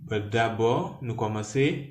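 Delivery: normal talking voice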